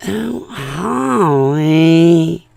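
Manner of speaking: loud, hugely drawn-out name calling; exaggerated mocking call, swooping up high at the end; mock name-mangling. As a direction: stretch the name out until it's ruined. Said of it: "they ruin it".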